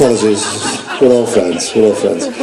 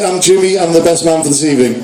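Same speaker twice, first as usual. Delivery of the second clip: ordinary delivery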